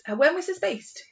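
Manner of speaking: usual speaking voice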